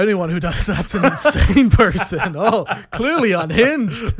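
loud, high, through laughter; laughing exclamation. Real, not acted. Host joking about anyone that dedicated, laughing loudly.

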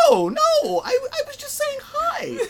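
very high, squealed mock protest; panicked denial. Comedian as Larry defending himself to his wife, squealed denial. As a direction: squeal it in loud, flustered falsetto.

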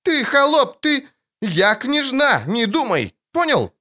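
put-on high, imperious child's tirade; squealing indignation. A narrator's reading. Princess puts Geralt in his place, squealing indignantly. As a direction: squeal it like an affronted little princess. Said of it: "взвизгнула она".

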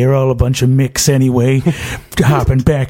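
1930s gangster. Acted. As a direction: threaten like an old movie mobster. Gangster mocks the fireman.

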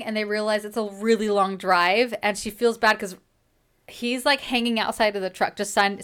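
ordinary talk, nothing marked

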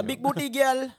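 high, loud mock Jamaican accent. Mimics Jamaican music accent.

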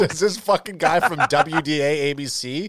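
laughing. Real, not acted. Mocking the clip's mispronunciation.

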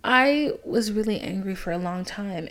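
usual speaking voice

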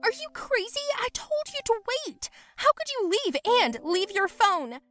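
high-pitched frantic telling-off; angry scolding. Acted. Friend to girl who left her phone, high agitated scolding. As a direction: scold in a shrill, outraged outburst.

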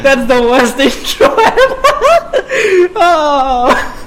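high, loud words squeezed through laughter; real laughing fit, slowed words; helpless laughing. Real, not acted. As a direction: talk while cracking up. Cracking up after botched intro.

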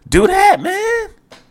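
sudden squeaky high pitch; voice gets high. Real, not acted. Host urging the coach to demote him, voice jumping high. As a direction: exasperation pushing the voice way up. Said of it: "you hear my voice get high".

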